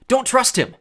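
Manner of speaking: high, loud urgent caution; warning. A narrator's reading. Grover warning Percy about the Cyclops, urgent. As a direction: stop a friend from a mistake. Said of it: "Grover warned".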